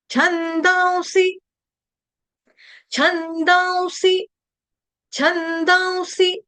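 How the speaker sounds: high, slow छंदांसी as chhandaun-si; correct short-vowel pronunciation. Shown as a demonstration. Teacher drilling the word, slow high chant with short ending. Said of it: "इसको दीर्घ नहीं करेंगे".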